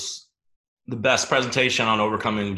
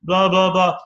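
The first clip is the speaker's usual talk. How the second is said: higher, louder mock whine; whiny self-doubt; 9 semitones higher, 6 dB louder than usual. Viewers doubting themselves next to [name], whiny. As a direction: mimic a doubtful viewer moaning. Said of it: "I know some of you are watching this going".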